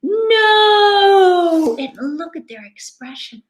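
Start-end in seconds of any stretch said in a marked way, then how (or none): 0.0-1.8 put-on high loud cry for the kids; shouted no. Shown as a demonstration. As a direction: shout it out big. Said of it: "Say it with me".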